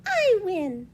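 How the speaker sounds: high, loud little rabbit boast; littlest rabbit. Acted. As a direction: claim victory as a tiny rabbit. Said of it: "said Littlest Rabbit".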